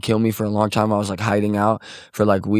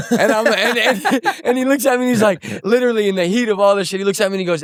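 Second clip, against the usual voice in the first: laughing while telling; 12 semitones higher than usual. Real, not acted. Laughs while telling the bat part.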